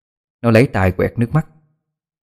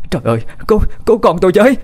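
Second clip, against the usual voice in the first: shrieking for help; 10 semitones higher, much faster than usual. Acted. Old mother screams to neighbours to save her child, piercing shout. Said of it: "la lên thất thanh".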